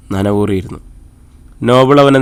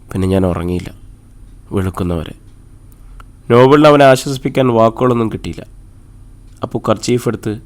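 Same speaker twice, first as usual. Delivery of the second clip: usual speaking voice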